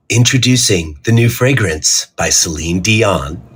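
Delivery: sultry perfume ad. Shown as a demonstration. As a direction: purr it like a perfume commercial. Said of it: "Tell us some of the voiceovers that you've done".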